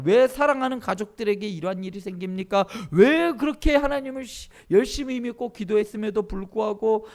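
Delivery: loud, rising plea of protest; acted complaint. Voicing believers' anguished complaint to God. (목소리 높여 하나님께 하소연하듯 따지기)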